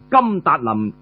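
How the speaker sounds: ordinary delivery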